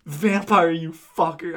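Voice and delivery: loud angry yell, the first word hit hard; furious mock shout at a vampire; angry outburst. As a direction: spit the insult out at full volume. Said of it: "I slapped him".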